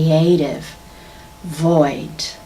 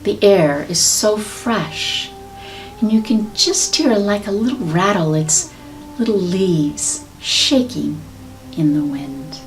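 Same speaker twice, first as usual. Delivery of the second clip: guided meditation. Acted. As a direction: guide them gently into visualization. Leads a meditation.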